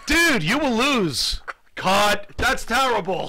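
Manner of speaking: high, loud, drawn-out verdict; mock outburst. Host mocking the actor who forgot lines, loud high outburst. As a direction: call it out like an appalled director.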